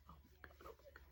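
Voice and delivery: very soft, whispered disbelief; re-enacted hushed shock; overwhelmed whisper. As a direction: whisper it to yourself, overcome. Acting out stunned reaction at work.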